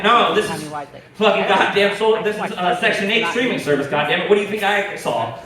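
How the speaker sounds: ordinary talk, nothing marked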